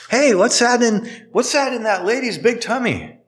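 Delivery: put-on high, curious little kid voice; curious child. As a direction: ask it like a wondering toddler. Child asking about pregnant lady.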